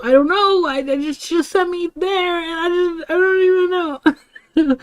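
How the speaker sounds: high-pitched, whiny helpless protest; flustered. Retold or imitated. Student explaining to angry friend about being sent there, flustered. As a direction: act out babbling cluelessly under questioning.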